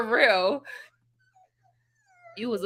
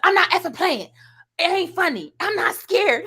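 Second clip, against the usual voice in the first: higher, louder furious yelling; angry cussing; 7 semitones higher, 5 dB louder than usual. Retold or imitated. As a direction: tell the scarers off hard. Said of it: "she's cussing these people out".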